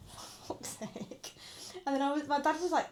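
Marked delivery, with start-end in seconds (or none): 0.1-1.3 slow unvoiced whisper, defeated; exasperated whisper. Opening grandparents' thin topper, slow whisper. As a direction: breathe it out slowly, fed up.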